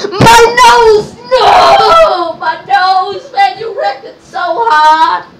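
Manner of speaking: high, loud wailing; drawn-out comic lament; mock agony. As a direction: wail over the injury, hammed up. Mock agony after being hit.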